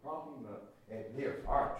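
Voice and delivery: ordinary delivery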